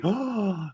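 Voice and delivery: high, soft imitated crowd gasp; mock shocked gasp. Podcaster imitating the Oscars crowd's shock, exaggerated high gasp. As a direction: gasp as if scandalised.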